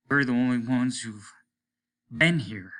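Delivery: ordinary delivery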